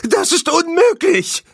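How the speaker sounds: very high, loud howl of disbelief; frantic viceroy's shriek. A narrator's reading. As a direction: wail it at the top of your range. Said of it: "heulte der Vizekönig".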